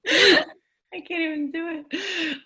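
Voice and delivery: breaking up laughing mid-sentence; giggling, can't keep it together. Real, not acted. Laughing through failed voice.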